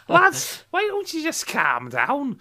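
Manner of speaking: high, slow comic Scouse; sketch-show Scouser pleading up high; exaggerated Scouse, drawn out and pitched high; Scouse accent. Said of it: "calm down" in the next one".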